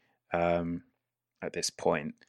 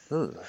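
mock dying choke; throat-cut gurgle; 7 semitones higher, 6 dB quieter than usual. Player voices a man's throat being cut, pretend choke. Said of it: "make the noise of a man's throat being cut".

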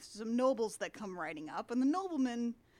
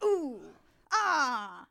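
mock wonder; 2 semitones higher, 5 dB louder, much slower than usual. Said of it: "He's just sort of like".

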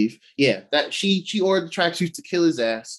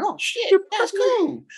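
much higher, giddy mock delight; mock excited Kate; slower than usual. Kate reacting to Clint calling her partner, mock excited. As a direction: squeal it like a thrilled sidekick.

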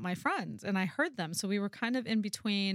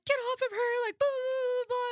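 imitating dad yelling in a much higher voice; high-pitched dad. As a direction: mimic dad's squeaky yell. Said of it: "has a really high-pitched voice".

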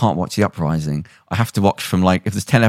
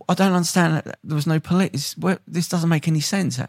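high, slightly softer protest of confusion; baffled, pitched up in disbelief; acted bafflement; 9 semitones higher, 4 dB quieter than usual. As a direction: play the bewildered viewer. Viewer's reaction acted out.

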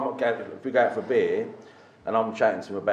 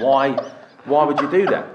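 loud, high, exasperated why; fed up, raising the voice; exasperated outburst; 5 semitones higher, 7 dB louder than usual. Retold or imitated. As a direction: throw up your hands at the intruder. Exasperated retelling of fan.

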